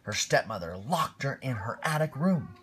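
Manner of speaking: lively read-aloud storytelling voice; storybook reading. A narrator's reading. Parent reading Cinderella to a small child, lively storytelling voice. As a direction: read it like a bedtime picture book.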